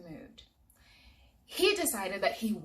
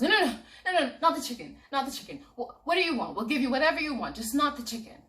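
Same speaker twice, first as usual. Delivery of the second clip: higher, louder frantic begging; panicked pleading; 6 semitones higher, 7 dB louder than usual. Acted. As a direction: beg in a panic. Israel panics over his chicken.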